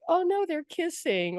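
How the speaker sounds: high mock dismay; teasing. Angels giggling at a movie kiss, silly teasing. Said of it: "teasing her".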